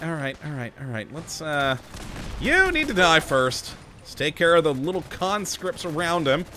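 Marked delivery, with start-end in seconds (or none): none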